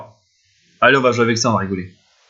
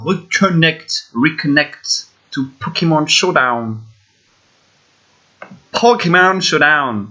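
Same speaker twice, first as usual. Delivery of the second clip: lecture traînante; much slower than usual. Real, not acted. Lit l'écran en traînant.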